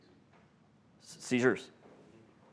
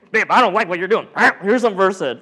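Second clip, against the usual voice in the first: a lightly voiced paramedic talking to a date, louder and higher; mock partner scolding; 5 semitones higher, 8 dB louder than usual. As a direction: tease it like a bored medic handing out sedation.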